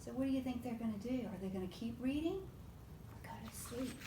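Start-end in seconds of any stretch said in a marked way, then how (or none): 3.1-4.0 softly whispered question; hushed whisper. Real, not acted. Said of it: "hushed question to child".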